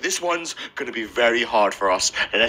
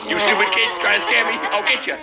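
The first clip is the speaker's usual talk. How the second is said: higher-pitched mock threat as the dad; imagined dad; 8 semitones higher than usual. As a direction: lightly voice a dad threatening the kids. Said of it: "He's like".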